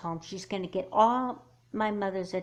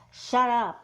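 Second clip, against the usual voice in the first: snapping; 5 semitones higher, 8 dB louder than usual. Acted. Snapping at friend.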